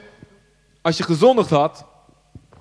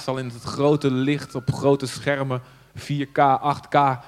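slow emphasis; 3 semitones lower, 4 dB quieter, much slower than usual. Real, not acted. Slow dramatic emphasis on exposure.

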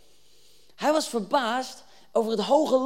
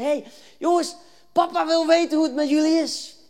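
loud, high, acted holler; calling out; 8 semitones higher, 7 dB louder than usual. David bringing father's message to his brothers, calling out. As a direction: hail them over a crowd.

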